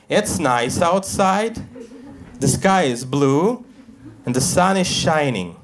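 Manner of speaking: slow schoolkid recitation of nice weather; rote class recital. Retold or imitated. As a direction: recite it dutifully like a pupil. Kid recites pretend nice weather.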